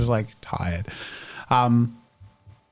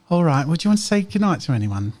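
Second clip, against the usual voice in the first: higher, slightly louder and kind; gentle adult voice; 6 semitones higher, 3 dB louder than usual. Acted. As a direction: Elise asks Medela whether she will say goodnight, kindly. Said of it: "Elise asks Medela".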